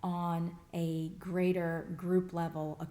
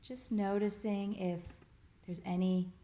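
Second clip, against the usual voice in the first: guided meditation. Real, not acted. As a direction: gently guide them through settling in, pausing between phrases. Leads group body scan.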